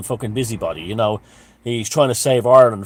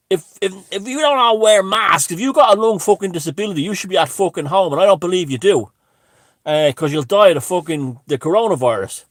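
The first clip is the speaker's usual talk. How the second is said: higher, louder hectoring man; a hammed-up scolding in a raised voice; mock angry man; 7 semitones higher, 5 dB louder than usual. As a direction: lecture him like a self-righteous busybody. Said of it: "he says to me".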